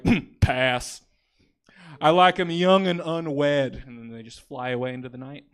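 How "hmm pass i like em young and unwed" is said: slow, loud, put-on dragon voice. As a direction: sneer it like a picky dragon. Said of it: "That was my impression of a dragon".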